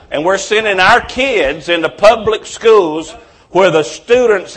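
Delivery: loud, rising sermon fervor, hammering each phrase; preaching at full force. Acted. Pastor preaching to his congregation, loud and fervent. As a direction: thunder it from the pulpit.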